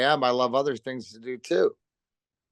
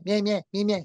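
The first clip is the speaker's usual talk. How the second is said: much higher, rapid chattering yeahs; Skippy's high voice; 7 semitones higher than usual. Retold or imitated. Teammate mimicking Skippy's chatter on the ice, high-pitched. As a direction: squeak it out like a nonstop chirper. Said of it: "'cause he just talked with a high pitch".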